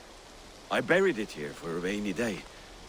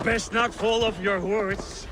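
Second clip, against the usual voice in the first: much higher, louder, slow and drawn out; drunken call from horseback; 10 semitones higher, 4 dB louder than usual. Acted. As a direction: yell a tipsy taunt over your shoulder while riding. Shouting while racing on horseback.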